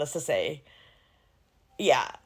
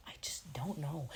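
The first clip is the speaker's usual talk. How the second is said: whispered aside, barely voiced; 5 semitones lower, 18 dB quieter than usual. Real, not acted. Confiding to her cohost that she doubts his acting, whispered. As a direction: drop to a secret whisper.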